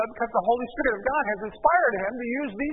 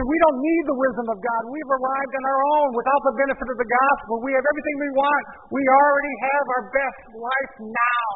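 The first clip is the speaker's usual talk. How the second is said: mocking smug voice; 5 semitones higher, 3 dB louder than usual. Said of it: "They say".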